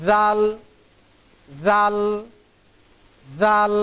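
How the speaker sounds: জাল said three times with a z-like sound, tongue tip at the upper teeth; teacher drilling জাল; repeated letter demo. Shown as a demonstration. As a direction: model জাল as zal for learners. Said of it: "এই হরফটির উচ্চারণ হবে".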